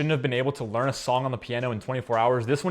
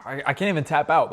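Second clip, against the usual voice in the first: usual speaking voice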